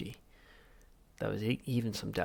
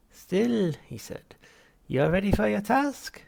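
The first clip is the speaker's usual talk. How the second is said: much higher, louder unctuous therapist; Halfrunt voice; 11 semitones higher, 9 dB louder than usual. Acted. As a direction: greet the patient with oily cheer. Psychiatrist voiced.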